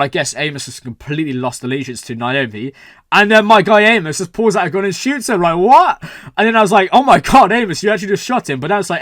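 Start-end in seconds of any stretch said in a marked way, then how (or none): 3.1-6.0 louder, higher recap of a shock; shocked disbelief. Real, not acted. Retelling a shocking twist in disbelief. 6.9-8.6 re-enacted shock, higher and louder; shocked exclamation. Acts out own shocked reaction.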